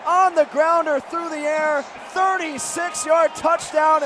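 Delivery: usual speaking voice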